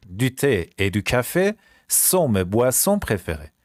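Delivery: slow French, pausing between phrases; slow repeat. Shown as a demonstration. Teacher repeats the favourite drinks sentence, slowly. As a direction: break it up slowly for learners.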